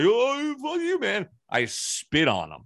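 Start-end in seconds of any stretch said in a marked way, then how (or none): 0.0-1.3 much higher, squeaky mock voice of the stranger; mocking the guy. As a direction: make the guy sound whiny and petty. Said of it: "And he's like".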